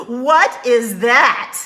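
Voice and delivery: big mocking laugh into the question; loud jeering laugh; brother laughing at him. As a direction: laugh hard, then sneer out the words. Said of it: "Leon burst out laughing".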